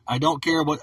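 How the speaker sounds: ordinary delivery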